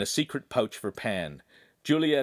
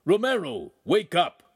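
louder call, stress on wake; shouted wake-up; 8 semitones higher, 5 dB louder than usual. A narrator's reading. Master shouts up to the loft a second time. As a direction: shout him awake again. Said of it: "the master's voice shouted".

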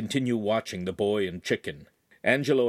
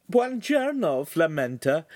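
much higher, gently refusing lady; a refined lady politely declining; signora's voice; 8 semitones higher, 4 dB louder than usual. Acted. Signora turning down the butcher's beef heart, much higher.

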